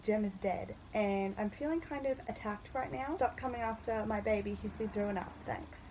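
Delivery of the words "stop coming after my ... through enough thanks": ordinary delivery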